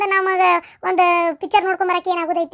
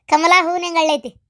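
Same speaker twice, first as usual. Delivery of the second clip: ordinary talk, nothing marked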